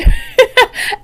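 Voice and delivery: high short laugh; amused laugh. Real, not acted. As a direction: laugh at the slip. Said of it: "that's not good".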